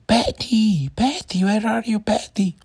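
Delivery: put-on very high, calling child's shout; boy screaming. A narrator's reading. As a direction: shout the bear's name over the fence. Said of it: "and screaming".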